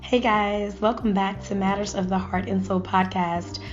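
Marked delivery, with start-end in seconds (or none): none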